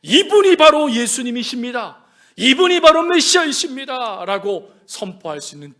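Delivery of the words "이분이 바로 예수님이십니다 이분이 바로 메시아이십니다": loud, ringing declaration; preacher's emphatic announcement; bold proclamation. Acted. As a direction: proclaim it out to everyone at full volume. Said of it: "그는 선포해요".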